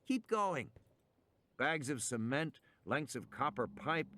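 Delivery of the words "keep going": put-on high, pressing child's voice; urging on. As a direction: push them to keep moving. Character urges on, high.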